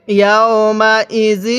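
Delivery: very slow, drawn-out syllables; model recitation. Shown as a demonstration. Teacher models Quran words for learners, slow measured recitation. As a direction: stretch each long vowel evenly. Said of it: "কিভাবে পড়তে হবে".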